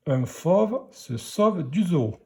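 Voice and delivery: unhurried reading for beginners; careful early-reader pace; slow sentence reading. Shown as a demonstration. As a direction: read it slowly and distinctly. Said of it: "pour la lecture de la phrase".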